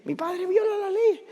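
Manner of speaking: put-on very high, drawn-out tattling child; daughter's voice. Daughter telling on her pastor father, childlike. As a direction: mimic a little girl on one high held pitch. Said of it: "Mi hija dice".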